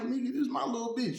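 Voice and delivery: high, fast defending her; quick protective retort. Retold or imitated. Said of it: "I'm like".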